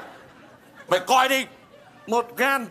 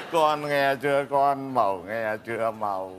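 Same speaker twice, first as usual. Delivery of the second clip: very deep voice; 9 semitones lower, 4 dB quieter than usual. Acted. Father pleading with daughter Màu, in a very deep mournful voice. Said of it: "Cái giọng trầm quá".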